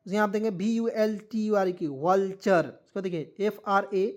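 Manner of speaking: ordinary delivery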